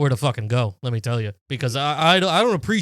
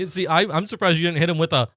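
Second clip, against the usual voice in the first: ordinary talk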